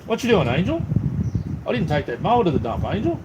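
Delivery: high sing-song call to the dog. Real, not acted. Man calls out to his dog Angel while remembering the mower, high sing-song. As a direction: lift the name high, calling her over.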